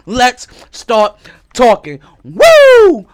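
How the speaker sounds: loud, high, slow hype call; hype shout. Real, not acted. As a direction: whoop to kick off the show. Host cheers, show starts.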